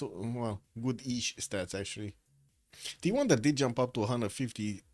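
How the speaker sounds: ordinary talk, nothing marked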